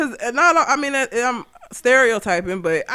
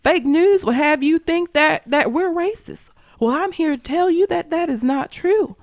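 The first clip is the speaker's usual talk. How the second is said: lightly mimicked speech-maker, higher pitch; Roy Moore's wife; 6 semitones higher than usual. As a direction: mimic her indignant little speech, pitched up. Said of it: "then you had his wife saying".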